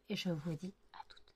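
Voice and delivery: soft unvoiced goodbye; whispered sign-off. Real, not acted. Vlogger signing off to viewers, whispered. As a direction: breathe it out quietly.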